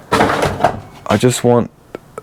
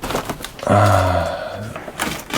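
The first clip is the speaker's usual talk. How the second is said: long quiet tired sigh; weary sigh; 8 dB quieter than usual. Real, not acted. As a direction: sigh at the mess. Overwhelmed by junk.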